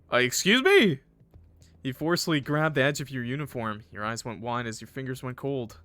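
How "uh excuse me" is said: high, loud incredulous question; real shocked objection; affronted disbelief. Real, not acted. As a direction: react as if outrageously offended. Affronted reaction to villain's line.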